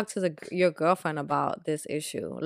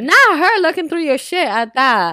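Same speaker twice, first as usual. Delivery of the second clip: loud, high-pitched disbelief; scandalized outburst; 11 semitones higher, 12 dB louder than usual. Real, not acted. Listener reacts to the friend's snooping story, scandalized shout. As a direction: burst in, incredulous and much louder. Listener exclaims at insurance card revelation.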